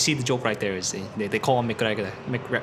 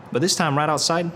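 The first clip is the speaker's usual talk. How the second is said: ordinary delivery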